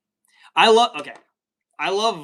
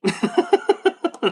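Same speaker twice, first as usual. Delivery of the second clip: high laugh. Real, not acted. Laughing at joke.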